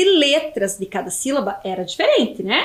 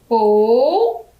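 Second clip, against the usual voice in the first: sounded-out syllable; 4 dB louder than usual. Shown as a demonstration.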